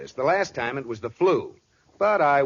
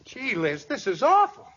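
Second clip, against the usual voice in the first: mock alarm; 10 semitones higher than usual. [name] fakes alarm to the doctor.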